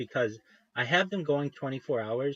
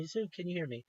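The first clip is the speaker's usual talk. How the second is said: calling again with the hope gone out of it; fading call; 5 semitones higher, 8 dB quieter than usual. Retold or imitated. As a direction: repeat the call, dropping to softer. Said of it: "I kept yelling at him".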